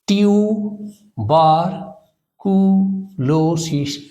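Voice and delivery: Tuberculosis as five slow, separate beats; syllable by syllable. Shown as a demonstration. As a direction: pause after each part: টিউ, বার, কু, লো, সিস. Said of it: "syllable ভাগ করে এটাকে যদি আমরা উচ্চারণ করি".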